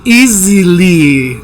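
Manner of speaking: "easily" slowly, the second I held long; long I demo. Shown as a demonstration. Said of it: "la de li es larga". ("easily" con I larga)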